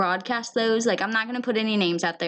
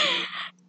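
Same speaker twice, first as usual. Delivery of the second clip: high giggle; 4 dB quieter than usual. Real, not acted. After retold overwhelmed reaction.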